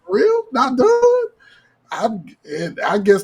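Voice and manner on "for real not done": mock high shocked woman. Woman's shocked reply in story.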